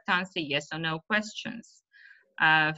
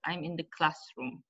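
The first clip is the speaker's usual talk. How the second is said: softer, linked "I'm in the classroom"; unstressed preposition; 6 dB quieter than usual. Shown as a demonstration. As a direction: let "in" pass quickly, unstressed. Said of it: "We pronounce it quickly because it's not stressed".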